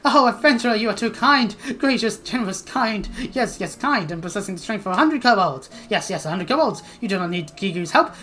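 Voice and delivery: effusive little creature, high on the exclamations; gushing kobold. Acted. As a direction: heap on flattery in an excitable kobold voice.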